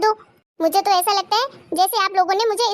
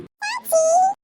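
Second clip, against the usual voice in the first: put-on high child's call for auntie; 11 semitones higher, much slower than usual. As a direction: call out to her like a kid. Child calls aunt from afar. (बच्चे की ऊँची पुकार)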